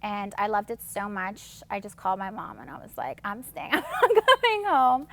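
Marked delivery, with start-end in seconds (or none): 3.2-5.1 high, loud, gleeful declaration; excited announcement. Retold or imitated. Telling mom she's staying in Toronto, excited announcement. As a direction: blurt it out high and loud, laughing a little.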